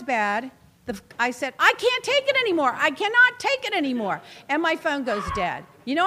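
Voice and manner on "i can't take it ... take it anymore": high, louder exasperated cry; high-pitched fed-up protest, re-enacted; retold desperate outburst. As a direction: replay being at the end of your rope.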